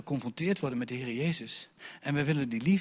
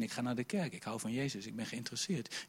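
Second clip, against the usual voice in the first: timid admission; 4 semitones lower, 12 dB quieter than usual. Retold or imitated. Quoted timid confession to friends.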